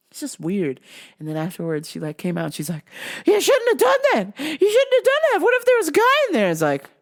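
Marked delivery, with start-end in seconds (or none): 3.2-6.5 high, loud, scandalised lady voice; mock scolding lady. Lady scolding him after the restroom, mock scandalised. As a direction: scold frantically as an imitated woman.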